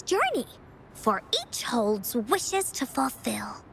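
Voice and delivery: gentle storybook narration; story narration. A narrator's reading. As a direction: tell it like a bedtime tale to eager listeners. Encore narrates the Woolly Warrior tale.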